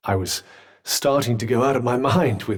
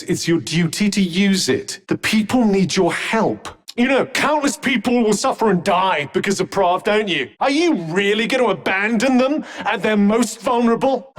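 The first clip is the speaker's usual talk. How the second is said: higher-pitched, insistent appeal; urgent plea; 5 semitones higher than usual. Acted. Companion urging the hero to save people, urgent. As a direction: press them hard to act.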